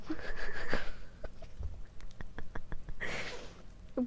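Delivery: breathless giggling fit; helpless, mostly voiceless laughter; laughing. Real, not acted. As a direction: crack up over your own attempt. Laughs at botched pronunciation.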